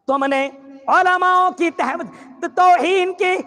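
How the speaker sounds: high, loud accusing outburst; forceful rebuke. Acted. Preacher accusing the hoarder, loud and high. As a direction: hurl the charge at him.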